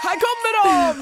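high-pitched call to the crowd; imitated gala host calling out; mock announcer's call. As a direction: announce an entrance with excitement. Imitating presenters announcing their entrance.

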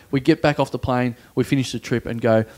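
ordinary talk

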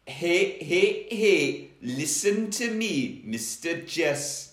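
slow Geordie warning; deliberate Geordie lilt; Geordie velociraptor. Acted. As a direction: warn them off in Geordie. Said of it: "he might be a Geordie Velociraptor".

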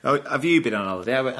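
ordinary talk

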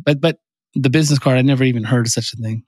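normal talking voice

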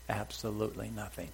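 ordinary talk

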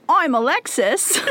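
slow mock British introduction; British accent. Host introducing herself to listeners in a mock British accent. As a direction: introduce yourself in hammed-up British. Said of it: "they're British".

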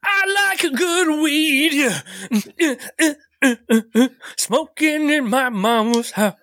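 giggly high-pitched pothead; high silly stoner voice. Acted. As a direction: float it up like you're blissfully high. Stoned character voice.

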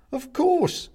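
very high, loud chirp; cheerful. A narrator's reading. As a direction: sing out breezily. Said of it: "says the man cheerfully".

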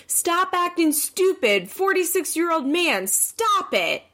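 high, slow, exasperated imitation; mock scolding. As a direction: imitate a fed-up girlfriend telling him off. Mocking retold scolding of boyfriend.

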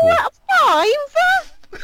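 high, slow, startled Cockney squeal; Barbara Windsor voice. Real, not acted. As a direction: shriek it like a soap landlady. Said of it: "you sound like Barbara Windsor".

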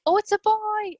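high, loud gasp of surprise; surprised joy. Retold or imitated. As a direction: burst out in delight at the reveal. Baby lifted over the curtain.

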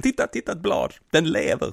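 put-on high, excited little-kid voice; playful mock childish delight; childlike wonder. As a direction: gasp at a leaf like a small child. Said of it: "Jag är lycklig som ett litet barn".

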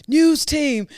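high, loud shout with a long drawn-out "assemble"; big movie-quote battle cry, last word stretched; Anchorman rallying call. Acted. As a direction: holler it like summoning the troops. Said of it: "on Anchorman where he goes".

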